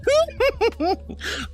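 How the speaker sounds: high burst of giggles; real giggle. Real, not acted. Streamer tickled by an orcs from New Jersey joke, giggling.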